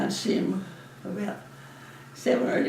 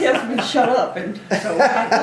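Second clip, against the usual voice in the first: giggling through the words, louder; laughing; 6 dB louder than usual. Real, not acted. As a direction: laugh as you tell it. Laughing while telling husband story.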